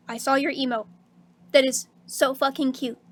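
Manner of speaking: normal talking voice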